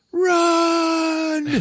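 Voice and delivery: long, high-pitched loud yell; hammed-up panicked shout. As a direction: scream it as a comic alarm. Tom Cruise yells to flee.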